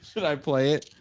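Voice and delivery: asked through giggles; giggly high question; laughing. Real, not acted. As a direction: laugh while asking. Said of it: "It's not funny at all".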